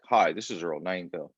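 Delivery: low, measured radio-host greeting, lightly imitated; a hint of an old record announcer opening his show; Earl Nightingale intro. Coach imitates the record's opening, low and measured. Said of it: "Earl Nightingale starts off like".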